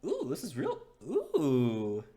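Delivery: high, gushing delight over a real pendant; excited. Acted. Garth admiring the pendant, squealing with delight. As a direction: gasp and gush, pitch way up.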